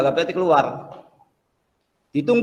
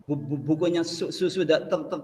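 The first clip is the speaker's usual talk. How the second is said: stammering, syllables repeated haltingly; stuttering job seeker. Acted. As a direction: stutter through every word like a nervous applicant. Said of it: "tahu ya orang gagap ya?".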